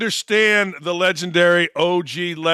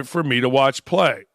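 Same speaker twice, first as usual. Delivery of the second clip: usual speaking voice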